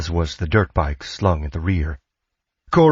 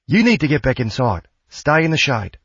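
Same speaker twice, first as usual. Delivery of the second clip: higher-pitched, insistent mum voice; concerned older woman's character voice; mother fussing over daughter; 7 semitones higher than usual. A narrator's reading. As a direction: urge your child indoors, pitched up. Mother orders daughter inside.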